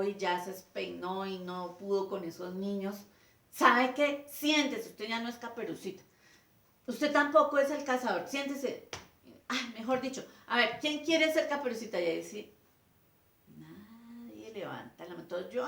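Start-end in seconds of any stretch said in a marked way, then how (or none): 3.5-12.0 higher, louder, fed up; teacher throws pupils out of the play; angry teacher. Retold or imitated. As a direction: dismiss them sharply. Teacher dismissing the actors.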